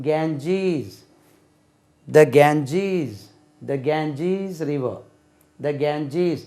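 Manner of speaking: "Ganges" as GAN-jeez, long ee; careful teacher's model of "Ganges" with stretched second syllable; English pronunciation. Shown as a demonstration. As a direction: end "கேஞ்சீஸ்" on a z after a long ee. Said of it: "ஜெட்ல முடியணும்".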